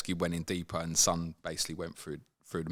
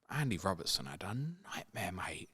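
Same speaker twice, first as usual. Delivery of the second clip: hushed aside; 10 dB quieter than usual. Real, not acted. Conspiratorial aside.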